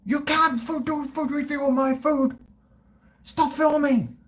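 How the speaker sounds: hammed-up shopkeeper banning the camera; imitating an irate woman shrilly forbidding filming; furious shop woman. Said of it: "and the woman goes".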